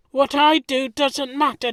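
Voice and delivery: ordinary talk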